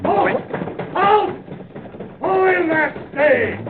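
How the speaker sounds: loud, high bandit yell to halt; shouted command. Acted. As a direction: bellow it to stop the coach. Bandit calling out to stop stage.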